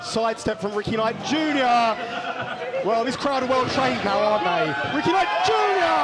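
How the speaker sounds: loud, excited play-by-play call; wrestling commentator. Real, not acted. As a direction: call the action ringside, building.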